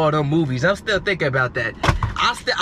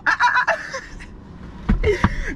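breathy giggling wheeze; squeaky laugh; high airy laugh. Real, not acted. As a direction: giggle without voice. Laughs after remark.

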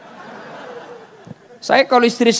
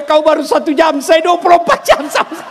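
emphatic retort; 8 semitones higher, 3 dB louder than usual. Acted. Husband's punchline to the jinn.

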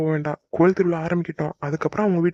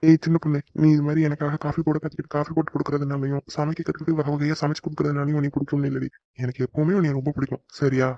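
tender reassurance; 3 semitones lower than usual. Acted. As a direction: tell her gently she is loved. Husband reassures wife of love.

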